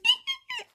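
squeaky high imitated laugh; imitating her laugh. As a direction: mimic the friend's wheezy laugh. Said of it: "she goes".

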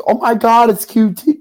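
high, loud fake gasp of joy; mock excited fan. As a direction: gush like a starstruck viewer. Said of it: "sees Q T and goes".

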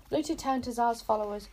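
ordinary delivery

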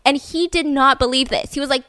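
ordinary talk, nothing marked

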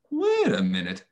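high-pitched halt to a spiralling thought; self-interruption. Retold or imitated. Speaker stopping their own self-doubt, sudden high-pitched halt. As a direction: pull yourself up short, pitch jumping high.

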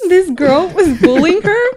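giggling through it; half laughing while telling. Real, not acted. Tells story while laughing.

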